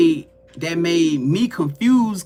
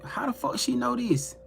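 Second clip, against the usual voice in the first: retold disbelief; 5 semitones higher, 7 dB quieter than usual. Retelling her baffled reaction to reading.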